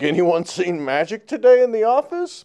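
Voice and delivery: high, loud sneering sarcasm; mock a coworker with fake innocence; sarcastic. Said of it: "these really sarcastic".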